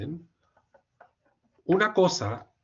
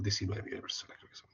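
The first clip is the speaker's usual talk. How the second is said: susurro rápido aparte; 4 semitones lower, 13 dB quieter, much faster than usual. Real, not acted. Aside correcting own figure.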